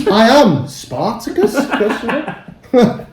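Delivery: I am Spartacus through laughter, then "Question mark" read out; laughing mock grammar. Friend joking about band names with punctuation, laughing. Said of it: "not enough bands with grammar in the name".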